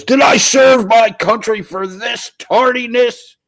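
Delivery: put-on high, slow shouted old-timer rant; mock outraged veteran. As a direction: bellow it like an indignant old vet. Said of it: "Sketchy Clown says".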